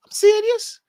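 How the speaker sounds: very high, loud Scouse indignation; Scouse impression. Mimicking the Scouse YouTuber, high indignant Scouse. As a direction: protest in a squeaky put-on Scouse accent. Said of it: "if you got the Scouse side of it".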